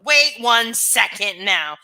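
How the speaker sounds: loud, slow mock outrage; mock indignant. Streamer fakes offence at a chat comment, loud and slow. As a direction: fake offence, drawn out loud. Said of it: "Just kidding, just kidding".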